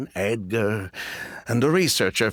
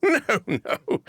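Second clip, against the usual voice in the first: amused brush-off through a laugh; chuckling no, no; dismissive laugh. Acted. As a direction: laugh it off, much higher. Laughs off the poison question.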